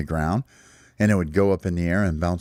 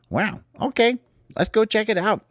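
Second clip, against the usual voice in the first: much higher, bright little-bird excitement; excited high chirp. Acted. Small eagerly tells the others to go look, excited.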